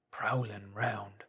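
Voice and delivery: slow whisper; hushed warning. Acted. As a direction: whisper it slowly, uneasy. Marvel warns.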